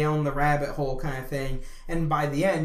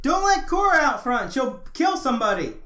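calling out; 7 semitones higher, 4 dB louder than usual. Real, not acted. Calling to someone out front.

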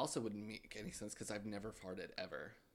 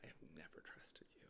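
secretive whisper; 8 semitones lower, 17 dB quieter than usual. Pretend. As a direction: breathe it as a secret. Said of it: "Let me tell you a secret".

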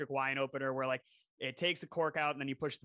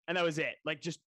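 ordinary talk

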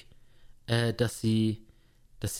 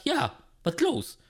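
called reply; 12 semitones higher, slower than usual. Retold or imitated. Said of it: "Ich sag".

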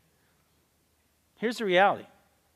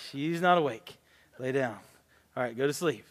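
hushed self-talk; 6 semitones lower, 5 dB quieter than usual. Retold or imitated. Sneaking back into bed at night.

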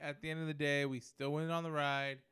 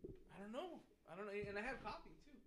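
nodding off; 5 semitones higher, 11 dB quieter than usual. Real, not acted. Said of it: "I'm so tired".

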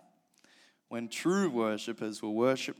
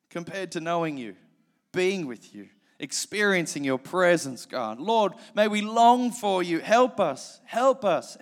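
rising, pleading prayer, louder and higher at its peak; fervent prayer; 3 semitones higher, 4 dB louder than usual. Acted. Preacher leads the church in prayer, fervent then softening. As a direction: pray with growing longing, then settle to amen.

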